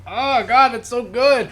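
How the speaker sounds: high gushing praise. Retold or imitated. Fan gushing over Magneto's arc, high and swooning. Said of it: "it's like".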